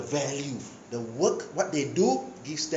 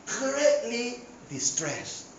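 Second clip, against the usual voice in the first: slow and heavy; 6 semitones higher, 6 dB quieter, much slower than usual. Shown as a demonstration. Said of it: "Second level".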